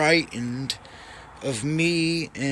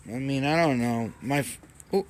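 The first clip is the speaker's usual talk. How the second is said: normal talking voice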